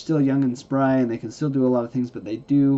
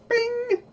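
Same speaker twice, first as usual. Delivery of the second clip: high bing sound effect. Acted.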